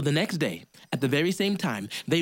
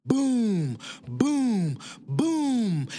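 nearer booms; 5 semitones higher, much slower than usual. Acted. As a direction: boom closer. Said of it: "then it got closer".

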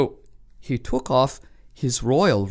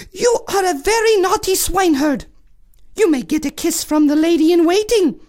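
cartoon princess; 4 dB louder than usual. Princess scolds swineherd.

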